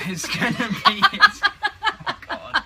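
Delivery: words breaking up into high laughter; laughing. Real, not acted. Laughing through her closing line.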